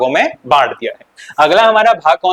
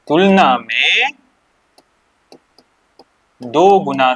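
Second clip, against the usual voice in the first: slow dictation; 3 semitones lower, slower than usual. Shown as a demonstration. A teacher dictating to pupils, slow and deliberate. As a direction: spell it out slowly, word by word. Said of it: "dictating slowly".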